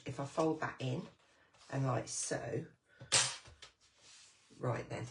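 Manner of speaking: usual speaking voice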